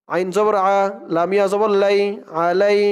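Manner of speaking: usual speaking voice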